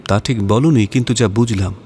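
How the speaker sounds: normal talking voice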